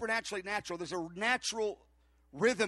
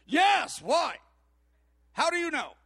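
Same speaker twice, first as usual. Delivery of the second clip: loud, higher mock astonishment; astonished; 5 semitones higher, 6 dB louder, slower than usual. Stranger whose kidney problem was guessed, astonished. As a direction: act out a stunned stranger, loudly. Stranger amazed at being known.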